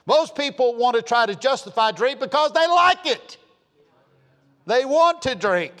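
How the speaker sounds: high, loud fiery pulpit preaching; preaching at full force. Real, not acted. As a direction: build it up and hammer the congregation.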